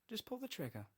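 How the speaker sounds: soft, high eerie urging; high, hushed temptation; coaxing sinister stranger. A narrator's reading. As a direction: urge him gently in a high, soft voice. Susuke coaxes him to suicide.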